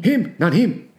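put-on high, loud caricature of an old man.

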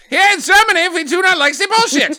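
high, loud hammed-up German accent; mock German accent. Mocking Germans' dislike of bullshit in a mock German accent. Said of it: "I think it's".

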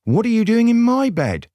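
high, indignant astonishment; shocked gasp. A narrator's reading. Soberina to the intruder in her bed, shocked. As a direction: blurt it high and aghast. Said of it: "she gasped".

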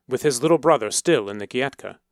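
plain reading voice

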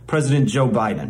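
normal talking voice

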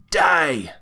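loud, high shouted attack; villain's death curse. Acted. Villain casting blood magic at Rick, shouted curse. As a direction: hurl it as a deadly command. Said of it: "and says".

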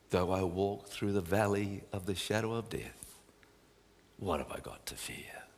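calming hushed voice. Acted. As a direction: murmur it as a promise of help. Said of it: "he has such a calming voice".

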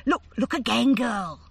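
urgent command. A narrator's reading. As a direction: press her to look again. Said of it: "snapped Maeve".

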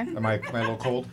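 talking while chewing; words round a bite of candy; mouth full. Real, not acted. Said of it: "it's 'cause you're eating".

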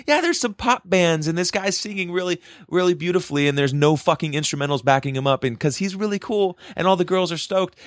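high, gushing mock-fangirl voice; airy mock enthusiasm; mocking pop fans. Mocking clueless pop-band fans.

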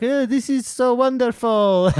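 high, slow, delighted tourist voice; dreamy tourist. Retold or imitated. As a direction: swoon over Paris like a naive tourist. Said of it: "you'll be in Paris like".

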